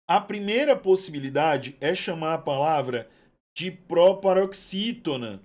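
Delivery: usual speaking voice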